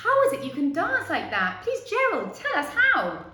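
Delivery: eager pleading. Acted. Animals asking Gerald how he dances, eager plea. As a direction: plead to learn his secret.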